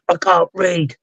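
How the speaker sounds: a hint of a child answering back; child's voice. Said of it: "you hear the child's voice".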